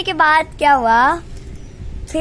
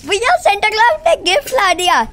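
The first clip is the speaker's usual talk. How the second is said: excited little brother; 7 semitones higher than usual. Retold or imitated. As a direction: burst in calling bhaiya with joy. Brother announces gift.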